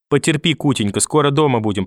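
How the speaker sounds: ordinary talk, nothing marked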